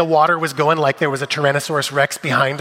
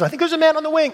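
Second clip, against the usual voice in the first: mock panic; 13 semitones higher, much faster than usual. Joking about his flight panic.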